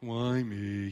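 lightly voiced gloomy donkey moan; Eeyore voice. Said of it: "in the voice of Eeyore".

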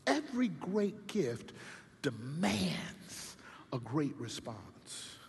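second time dropping to a whisper; quiet echo of the maxim; hushed repeat. Shown as a demonstration.

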